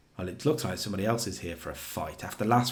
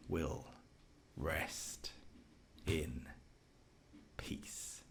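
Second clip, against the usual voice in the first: slow and low, sinking into a whisper at the end; Undertaker voice; 3 semitones lower, much slower than usual. Retold or imitated. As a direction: drag it out like a menacing deadman, dying away to a whisper. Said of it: "you attempted to try and go that deep Undertaker voice".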